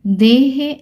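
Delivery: देहे sounded out high and loud, both syllables clear; word demonstration. Shown as a demonstration. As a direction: model देहे for pupils to repeat. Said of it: "क्या बोलेंगे". (देहे साफ़, ऊँचे सुर में)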